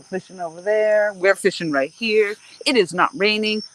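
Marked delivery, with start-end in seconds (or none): none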